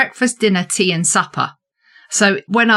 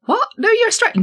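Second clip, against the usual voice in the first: mock disbelief; faster than usual. Acted doubter insists Australian.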